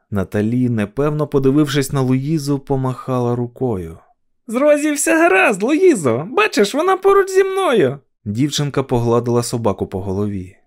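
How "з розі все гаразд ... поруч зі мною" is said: put-on very high child voice; high, reassuring child's call; little girl calling out. A narrator's reading. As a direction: call across the road in a little girl's voice. Little girl calls to Louisa.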